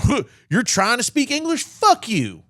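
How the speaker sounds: high, scornful mock sneer; mocking rude local. He mocks an imagined rude local for trying English, scornful. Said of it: "anybody in America going".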